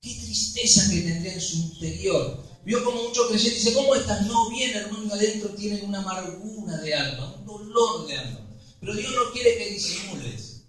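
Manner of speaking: hushed, intimate sermon voice; soft preaching. Acted. Pastor consoling hidden sorrow in the congregation, hushed and tender. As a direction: lean in and speak softly to the hurting.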